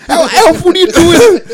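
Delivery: high, loud yell at a bully. Retold or imitated. Kid yelling at the bully to stop teasing the girl.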